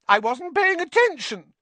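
very high, loud melodramatic wail; theatrical, squeaky self-pity; dramatic. Acted. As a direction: play it like a tragic ghost girl. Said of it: "said Myrtle dramatically".

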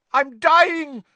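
very high, loud panicked yell; Malfoy's yell. A narrator's reading. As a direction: scream as if mortally wounded. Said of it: "Malfoy yelled".

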